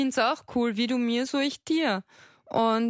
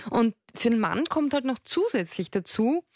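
ordinary delivery

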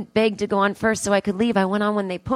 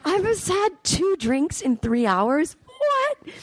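high, slow, squeaky disbelief; mock incredulity; 11 semitones higher, slower than usual. Comic replaying her shock at being offered another drink. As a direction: stretch it in scandalised glee. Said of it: "I'm like".

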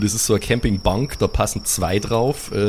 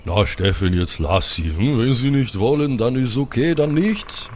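Viking chief voice; 2 semitones higher than usual. Acted. GM voicing Björn.

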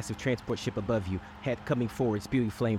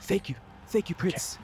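grateful father; 8 semitones higher than usual. Acted. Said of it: "her father says".

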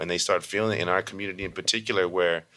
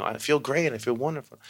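put-on kids answering; 6 semitones higher than usual.

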